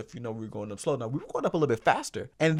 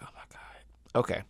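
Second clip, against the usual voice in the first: resigned whisper; 3 semitones lower, 6 dB quieter than usual. Retold or imitated. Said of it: "I was just like".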